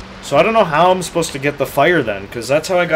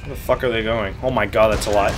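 normal talking voice

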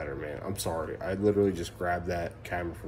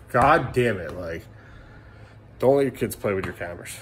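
usual speaking voice

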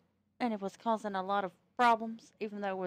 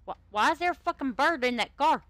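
loud, higher, baffled exclamation; sudden real surprise, louder and higher; startled outburst; 7 semitones higher, 11 dB louder than usual. Real, not acted. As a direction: blurt it out in loud disbelief. Sudden surprise at bird in car.

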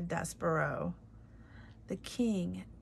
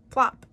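"Plop" popped out loud and higher; plop sound; 5 semitones higher, 13 dB louder than usual. Shown as a demonstration. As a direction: say "Plop" like a drop hitting the floor. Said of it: "That's a word that sounds like what it means".

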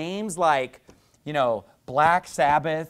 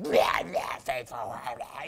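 demonic voice; 7 semitones lower, 5 dB quieter, slower than usual. Retold or imitated. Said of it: "it's just like".

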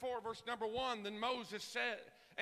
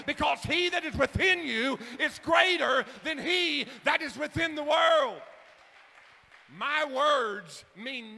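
loud, raised shout from the pulpit; full-force preaching; 3 semitones higher, 12 dB louder than usual. Real, not acted. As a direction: thunder it out to the congregation, hammering the key words.

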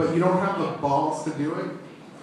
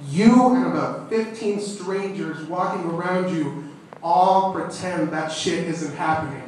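ordinary talk

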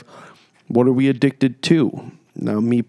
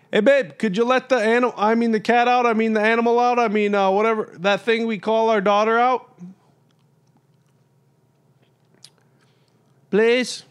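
much higher, teasing wife imitation; 12 semitones higher than usual. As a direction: play the wife asking sweetly. Voices wife's request in message.

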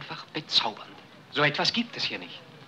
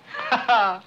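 loud, high triumphant laugh; gleeful laugh; 8 semitones higher, 6 dB louder than usual. Acted. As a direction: laugh out with delight at the plan. After vowing to win her. (fröhliches Lachen)

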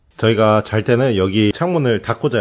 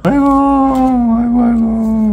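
fond exclamation; 11 semitones higher, 5 dB louder, slower than usual. Real, not acted. Cooing over baby photo.

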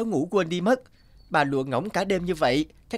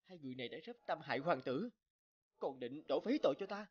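ordinary delivery

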